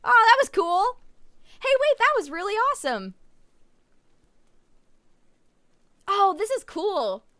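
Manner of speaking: high, loud and thrilled; excited. Real, not acted. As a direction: gush with delight. Delighted by game transition.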